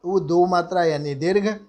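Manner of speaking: ordinary talk, nothing marked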